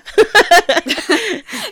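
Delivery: big high burst of laughter; loud laugh. Real, not acted. Cohost laughing hard at the cheese joke, loud burst.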